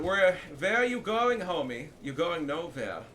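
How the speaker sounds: put-on loud, slow German-accented taunt; hammed-up German villain, high and drawn out; playful German accent, toying with prey; mock German accent. Mock German taunt at fleeing enemy.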